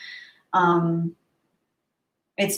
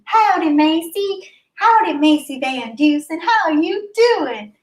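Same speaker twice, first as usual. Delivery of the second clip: playful howdy greeting; 9 semitones higher, 4 dB louder than usual. Real, not acted. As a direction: greet a friend in a big swooping voice.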